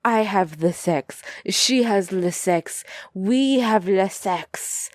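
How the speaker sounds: put-on slow, deliberate French-accented English; mock French accent. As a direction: spell it out slowly like a Frenchman. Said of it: "the guy is like".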